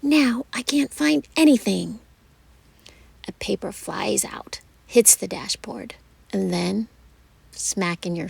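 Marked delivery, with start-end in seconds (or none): 0.0-2.0 indignant complaint. Acted. Mom complaining triumphantly.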